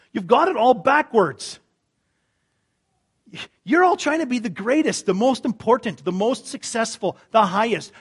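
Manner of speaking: loud, high, urgent preaching; fervent sermon climax; preaching at full force. Acted. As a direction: hammer the point home.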